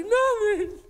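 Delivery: high, clipped wife voice; a husband quoting his upset wife; wife's nothing. Retold or imitated. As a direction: mimic the wife's curt reply.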